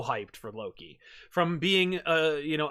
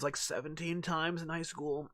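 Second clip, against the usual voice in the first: ordinary talk, nothing marked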